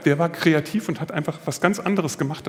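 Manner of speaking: normal talking voice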